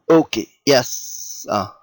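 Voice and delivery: usual speaking voice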